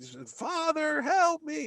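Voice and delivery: high mock plea; imitating Luke calling for help; Luke pleading. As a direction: beg your father in a high voice. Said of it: "He's like".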